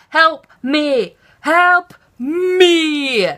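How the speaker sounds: loud, high pleading wail, second cry stretched out; acted silent plea. Scared passenger telepathically begging strangers, hammed-up desperate plea. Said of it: "trying to send them, like, telepathic messages".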